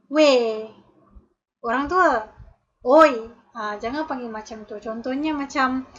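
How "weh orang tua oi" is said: loud rude call at a stranger; rude shouting. Shown as a demonstration. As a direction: bark it out like a rude customer. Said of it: "panggilan yang tidak sopan seperti". (jeritan kasar dan kurang sopan kepada orang tua)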